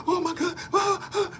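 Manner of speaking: high, soft panicky gasping; mock panic gasp. Mocking a viewer who panics at the news.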